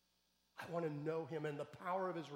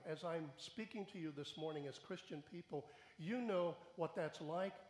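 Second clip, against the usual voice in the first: ordinary talk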